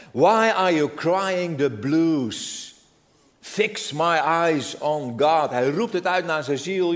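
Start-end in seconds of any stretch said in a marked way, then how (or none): 0.1-5.5 drawn-out lament reading. Acted. Preacher dwells on the lament.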